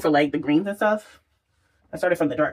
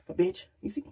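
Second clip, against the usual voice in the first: whisper; 4 semitones higher, 10 dB quieter than usual. Real, not acted. Shows glow to camera.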